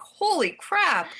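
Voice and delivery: high, slow stunned exclamation; stunned husband. Retold or imitated. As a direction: drag it out in disbelief. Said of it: "he was like".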